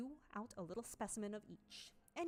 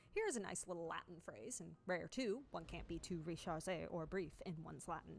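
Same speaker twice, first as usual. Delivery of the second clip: usual speaking voice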